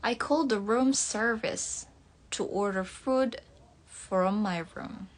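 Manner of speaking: careful American English with r's sounded; American accent. Shown as a demonstration. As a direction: read it like an American, r audible. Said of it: "logat American ya". (logat American)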